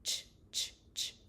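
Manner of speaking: whispered "ch" with lips pursed. Shown as a demonstration. Therapist showing pursed lips for ch. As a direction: push the ch through pursed lips. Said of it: "notice how my lips are pursed".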